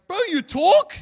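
high, loud, stunned yelp; shocked outburst. Retold or imitated. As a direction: blurt it out in total disbelief. Shocked he suddenly speaks.

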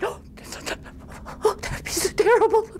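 high, hammed-up despairing moan; mock dismay.

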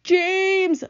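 high, drawn-out bawled name; bawling. A narrator's reading. Centipede calling James for rescue, bawling. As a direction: bawl the name out pitifully. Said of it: "bawled the centipede".

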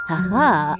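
plain reading voice

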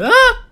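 sudden high, loud "ah"; startled yelp. Real, not acted. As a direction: cry out sharply in surprise. Startled yelp while playing.

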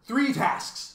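mildly delighted, emphatic; happy. A narrator's reading. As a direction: announce it brightly and eagerly. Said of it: "he said happily".

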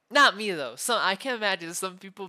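loud, insistent protest; hasty loud denial. Acted. Captor insisting they are not psychotic, loud denial. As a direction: deny it too loudly. Hastily denies being psychotic.

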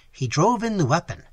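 ordinary delivery, nothing marked

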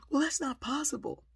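high, loud defensive denial; frazzled teacher. Retold or imitated. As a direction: imitate a flustered teacher refusing to believe. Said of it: "she's all frazzled".

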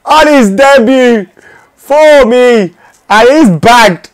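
high, loud and thrilled; elated shouting. Real, not acted. Gamer celebrating his debutant's goal, loud and high.

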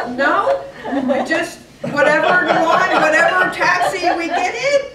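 loud, high, laughing through the words; can't stop laughing while explaining; laughing reply. Real, not acted. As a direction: answer while still cracking up. Mom answers son through laughter.

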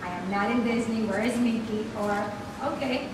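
ordinary talk

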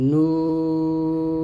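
নুন held very long and level; tongue-tip letter demo. Shown as a demonstration. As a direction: stretch নুন, closing with tongue tip on palate. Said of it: "জিহ্বার আগারটা উপরে তালুর সঙ্গে লাগবে". (জিহ্বার আগা লাগিয়ে টানা নুন)

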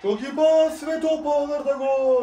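high, loud, slow goal scream; screaming football commentator. Real, not acted. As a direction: belt out the goal call. Live goal call while watching.

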